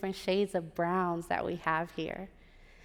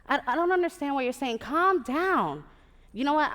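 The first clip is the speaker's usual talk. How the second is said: higher, louder, exasperated phone retort; 6 semitones higher, 6 dB louder than usual. Retold or imitated. Her on the phone to her upset program director, exasperated. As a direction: act out telling an upset caller off.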